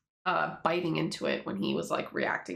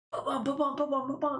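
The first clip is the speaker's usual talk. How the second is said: high, repeated mock heartbeat thumps; imitated pounding heart sound effect; panicked heartbeat; 7 semitones higher, faster than usual. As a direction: voice a racing heart, pitched up. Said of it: "And then he freaks out".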